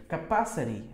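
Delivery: CAPACITY ending in "ri"; American flapped T. Shown as a demonstration. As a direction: soften the T of CAPACITY to a tap. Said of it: "No, no inglês americano". ("Capacity" com R brando no final)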